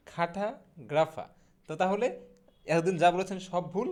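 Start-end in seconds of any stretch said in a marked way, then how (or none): 0.1-1.3 খাঠাগ্রাফা very slowly, split "kha-tha ... gra-fa"; broken-down pronunciation. Shown as a demonstration. Teacher modelling cartographer slowly, খাঠাগ্রাফা as "kha-tha, gra-fa". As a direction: draw out খাঠাগ্রাফা in two pieces, no r at the end. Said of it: "সঠিক উচ্চারণটা হবে হচ্ছে".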